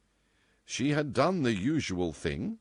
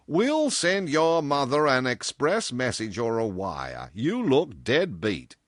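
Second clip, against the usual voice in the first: loud, slow, higher character voice; insistent boss; 5 semitones higher, 5 dB louder, slower than usual. A narrator's reading. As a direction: overrule her with slow, loud certainty. Boss overrules her, insisting.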